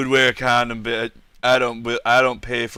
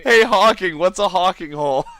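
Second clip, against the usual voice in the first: loud teasing shout; playful call-out; 6 semitones higher, 4 dB louder than usual. Real, not acted. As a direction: razz a friend loudly. Mock call-out to friend.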